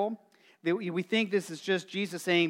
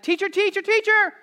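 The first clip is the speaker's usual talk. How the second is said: put-on pestering child; 13 semitones higher, 7 dB louder than usual. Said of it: "he's like".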